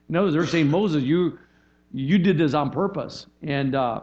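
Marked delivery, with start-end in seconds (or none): none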